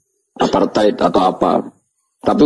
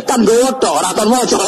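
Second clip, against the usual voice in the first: nada sangat tinggi, menyela; balasan main-main; 14 semitones higher than usual. Acted. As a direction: balas seperti anak yang ngeyel. Playful retort, high. ([name] menyela dengan nada sangat tinggi)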